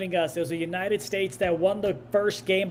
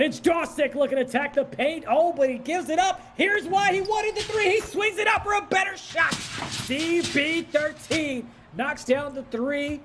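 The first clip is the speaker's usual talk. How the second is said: excited play-by-play; 9 semitones higher than usual. Real, not acted. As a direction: climb high into a shouted call. Live play-by-play call.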